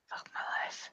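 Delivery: hushed unvoiced curse under the breath; breathy whispered aside. Real, not acted. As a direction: whisper it to yourself in dismay. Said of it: "unvoiced whisper".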